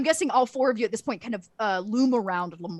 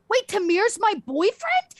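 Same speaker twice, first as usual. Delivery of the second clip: high, slow, loud baffled question; loud surprise; 11 semitones higher, 5 dB louder than usual. Acted. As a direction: blurt it so bystanders turn and look. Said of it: "He says, like, loud enough".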